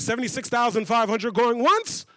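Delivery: loud call rising at the end; auctioneer. Acted. Auctioneer back at the podium, loud rising call. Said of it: "The auctioneer's gotta keep the process going".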